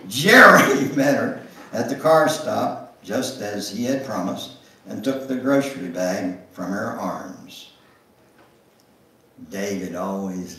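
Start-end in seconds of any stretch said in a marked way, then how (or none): none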